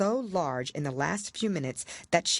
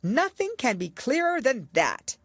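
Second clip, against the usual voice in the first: triumphant king; 9 semitones higher, 4 dB louder than usual. A narrator's reading. King pointing at the tarts, triumphant. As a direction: crow it triumphantly.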